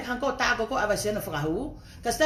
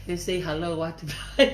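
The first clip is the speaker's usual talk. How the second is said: normal talking voice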